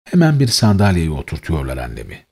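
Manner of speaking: plain reading voice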